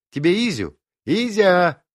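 calling loudly across the flat, high. Acted. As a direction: shout for him to come. Аля calls Изя to the phone.

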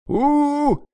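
high drawn-out howl like a coyote. A narrator's reading. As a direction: howl it out in pain. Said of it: "завыл койотом апостол". (вой койотом)

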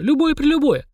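put-on very high, awestruck girl; gasping amazement. A narrator's reading. Mashka marvelling at any wish at all, amazed. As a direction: squeal it in wonder. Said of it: "ахала Машка".

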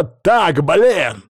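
loud, high, exasperated snap; angry outburst. A narrator's reading. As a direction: cut in, fed up and angry. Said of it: "разозлился уже я". (сердито и громко, теряя терпение)

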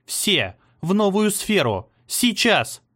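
building from firm to high yell; fading in to a shout. A narrator's reading. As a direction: force the command out through pain. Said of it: "её голос стал громче, и она заорала".

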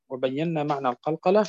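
usual speaking voice

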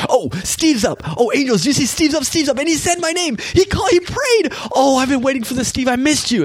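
giddy, high-pitched delight; God. Acted. Imagining God thrilled that Steve woke up, excited high voice. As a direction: gush excitedly, pitch climbing as you announce the news. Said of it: "that God's sitting there and".

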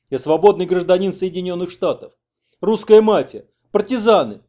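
loud, high-pitched protest; outraged shouting. Acted. Interpreter translates the American's protest to villagers, outraged shouting. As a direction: shout indignant accusations.

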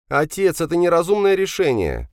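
high-pitched protesting son; objecting. Acted. Son objects to father's decision. (возражает высоким голосом)

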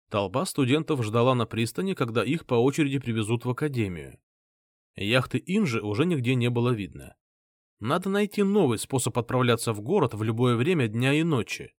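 usual speaking voice

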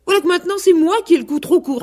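high, fast, offended rooster; indignant retort. A narrator's reading. Vexed rooster protests to the goose, indignant. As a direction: rattle it off high in outrage.

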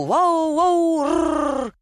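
high howling woof into a growl; bay like a dog, high, then growl; drawn-out doggy bow-wow; dog barking. Acted.

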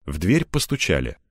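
plain reading voice

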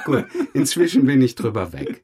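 laughing reply. Real, not acted. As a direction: shrug it off while laughing. Replies still laughing.

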